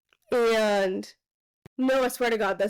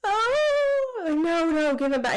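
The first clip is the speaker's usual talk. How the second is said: high, desperate mock plea; hammed-up panicked cry, much higher; pleading; 7 semitones higher, slower than usual. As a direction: beg to get him back, voice jumping high. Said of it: "And he says".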